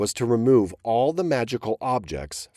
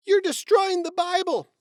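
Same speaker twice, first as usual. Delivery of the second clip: much higher, dismayed cry; mother shouting. Acted. Lucy Mack cries out to her sons over the Bible, much higher. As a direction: shout in dismay at the torn pages. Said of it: "Lucy Mack shouted".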